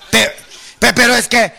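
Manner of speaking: ordinary delivery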